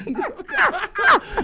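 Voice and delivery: amused high laugh. Real, not acted. Laughs at own old lyric.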